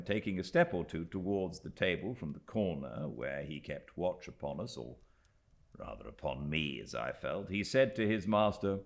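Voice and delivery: usual speaking voice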